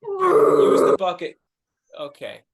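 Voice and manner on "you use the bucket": loud, fed-up outburst; exasperated shout. Acted. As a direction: yell it in defeat.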